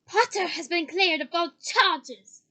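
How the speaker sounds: slow, very loud declaration. A narrator's reading. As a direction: boom it out, stretching each word. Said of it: "even more loudly".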